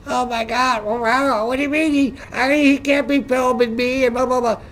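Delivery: high, huffy mock Karen voice; hammed-up indignant woman, pitched high; mock outraged Karen. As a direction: parody a flustered lady losing her argument. Mocking upset ladies at post office.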